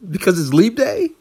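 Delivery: high-pitched, loud astonished question; shocked disbelief. Acted. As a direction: gasp it out in total surprise. Sudden proposal surprise.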